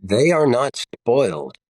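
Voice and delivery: ordinary talk, nothing marked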